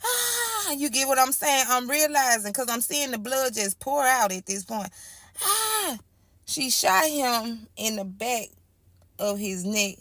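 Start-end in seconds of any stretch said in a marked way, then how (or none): 0.0-0.7 sudden high-pitched shriek, held back in volume; a short, sharp cry of shock, re-enacted; high scream from the story, not full force; retold scream. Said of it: "Now I'm screaming". 5.4-6.0 sudden high-pitched shriek, held back in volume; a short, sharp cry of shock, re-enacted; high scream from the story, not full force; retold scream. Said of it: "Now I'm screaming".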